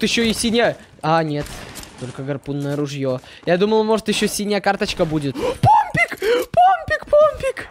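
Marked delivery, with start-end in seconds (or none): 5.4-7.7 very high thrilled squeal; excited shriek. Real, not acted. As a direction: squeal with delight. Finds pump shotgun, thrilled.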